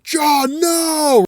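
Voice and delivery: high, stretched-out desperate call; anguished mock cry. Ben beating on the door as Johnny stays, anguished cry.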